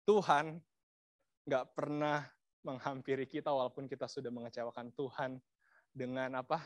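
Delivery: ordinary talk, nothing marked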